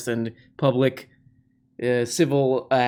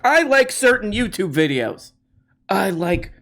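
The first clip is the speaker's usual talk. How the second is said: mocking protester; 6 semitones higher, 3 dB louder than usual. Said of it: "more to this than just like".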